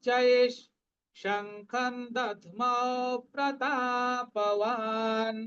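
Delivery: verse recitation. Shown as a demonstration. Teacher chanting verse twelve for students. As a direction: chant it out slowly. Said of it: "এবার বারো নম্বর শ্লোক".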